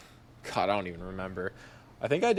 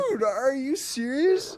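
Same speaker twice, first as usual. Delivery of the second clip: much higher, slow, near-crying whine; mock disbelief; 13 semitones higher than usual. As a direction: plead in mock despair. Disbelief at the countdown.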